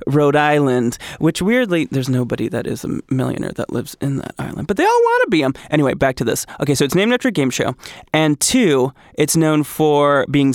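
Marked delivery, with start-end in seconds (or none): 4.7-5.5 squeaky punchline. Acted. Joke punchline voice.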